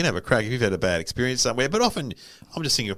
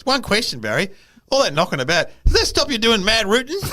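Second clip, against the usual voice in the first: put-on old guy asking; 10 semitones higher, 5 dB louder than usual. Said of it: "and he goes".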